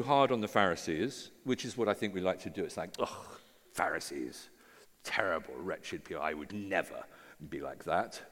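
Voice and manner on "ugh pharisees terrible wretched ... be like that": low, smug, self-righteous sneer; mock the holier-than-thou tone, lower; lightly voiced pompous Pharisee; Pharisaical voice. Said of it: "said in my best Pharisaical voice".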